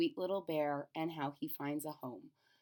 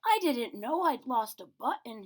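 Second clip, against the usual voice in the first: a small bear surprised at himself; teddy bear musing aloud; Corduroy to himself; 4 semitones higher, 5 dB louder than usual. Acted. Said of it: "he said to himself".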